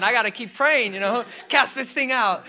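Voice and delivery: loud, high, half-laughing resolve; playfully hammed-up prayer zeal; mock urgency. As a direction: act out fervent determination for laughs. Acts out his thought, laughing.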